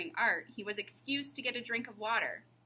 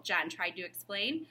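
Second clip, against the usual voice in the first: ordinary delivery, nothing marked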